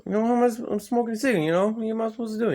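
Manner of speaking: mocking actress.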